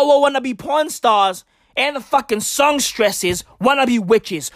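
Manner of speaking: ordinary talk, nothing marked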